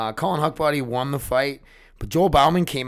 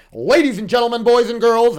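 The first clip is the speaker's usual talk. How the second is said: loud, high ring announcer call; 12 semitones higher, 11 dB louder than usual. Shown as a demonstration. Vlogger showing off his announcer voice to viewers, loud announcer call. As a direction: boom it out like a fight announcer, pitched way up. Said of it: "That's my announcer voice".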